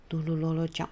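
plain reading voice

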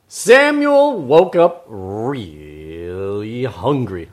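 drawn-out storyteller voice; Robert Munch. Acted. Host warms up to read the story, slow storyteller voice. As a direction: stretch the words for a young audience. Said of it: "get my Robert Munch voice going".

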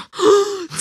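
sharp high gasp of shock; surprised gasp. Retold or imitated. Girlfriend hearing the truth, shocked gasp. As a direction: gasp as if struck by news.

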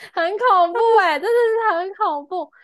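high, loud horrified squeal; shrill-free high-pitched horror, half laughing. Real, not acted. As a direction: squeal how scary it was. Horrified exclamation, high far voice.

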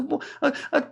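high, halting, flustered put-on voice; imitating her hesitation. Mimicking his girlfriend fumbling an excuse in the car, high and hesitant. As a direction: stammer it out like a flustered girl. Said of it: "他说".

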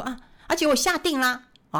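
normal talking voice

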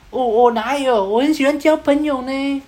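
high-pitched protesting tough-guy impression; wannabe gangster. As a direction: play a punk insisting he's friendly, pitched high. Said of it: "是个加九".

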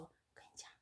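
breathy hushed secret; boss's conspiratorial whisper. Retold or imitated. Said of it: "他就走，走到我旁边".

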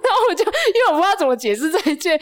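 high, laughing and lost for words; flustered laughing. Real, not acted. Admitting to her friend she fumbled, laughing high. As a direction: laugh at herself, unsure how to explain.

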